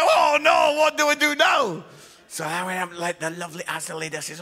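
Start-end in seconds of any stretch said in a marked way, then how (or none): 0.0-1.8 high, loud hammed-up panic; mock panic. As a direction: wail it like a lost man in a shop. Panicking in the supermarket.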